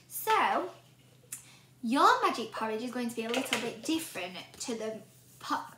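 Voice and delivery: plain reading voice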